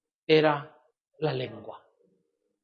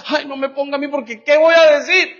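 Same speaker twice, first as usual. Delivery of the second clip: louder and higher, pleading "¿qué voy a decir?" with a rising whine; whiny excuse; 5 semitones higher, 4 dB louder than usual. Retold or imitated. Preacher imitates a believer whining to get out of praying. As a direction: beg off like a reluctant believer. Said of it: "mire cómo habla la descalificación".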